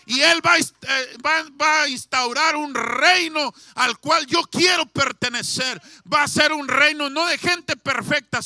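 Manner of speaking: preaching at full force, shouting the thief's words; fervent shout. Acted. Preacher shouting the thief's confession to the congregation, fervent.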